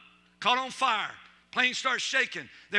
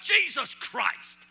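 retold curse; 4 semitones higher, much slower than usual. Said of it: "some guy yells out".

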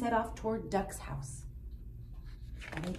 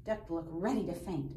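ordinary delivery, nothing marked

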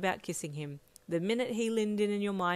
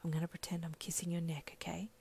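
whispering; 8 dB quieter than usual. A narrator's reading. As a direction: whisper it into her ear. Whispered plan.

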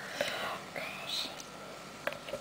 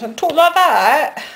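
high, loud mock English accent; 5 semitones higher, 9 dB louder, slower than usual. Teasing friend with an offer to film in English accent, mock English accent. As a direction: put on a swooping, hammed-up English accent. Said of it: "Should I do this all in English accent".

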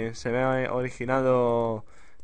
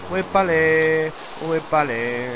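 loud, slow, stretched-out whoop; drawn-out exclamation; 2 semitones higher, 6 dB louder, much slower than usual. Real, not acted. Player dodging danger in a game, drawn-out exclamation. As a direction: stretch the vowels out loudly.

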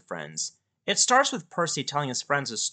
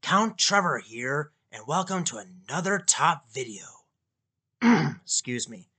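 dark lord; 2 semitones lower, slower than usual. Acted. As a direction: greet them like a vampire count. Spooky host intro as Count.

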